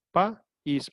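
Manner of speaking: "pa, is" as two separate syllables; split into syllables. Shown as a demonstration. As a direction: break it apart: "pa", then "is". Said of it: "se divide en dos sílabas". («pa, is» separado en dos sílabas)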